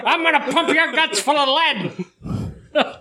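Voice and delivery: put-on slow, high old gangster drawl; gangster movie quote. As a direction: ham up a tough-guy film line. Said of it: "picturing Home Alone".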